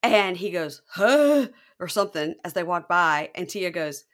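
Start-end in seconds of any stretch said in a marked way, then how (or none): 0.9-1.5 a loud high startled huh; sudden yelp of surprise. Retold or imitated. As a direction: blurt it out, caught off guard. Deontay grunts as they pass.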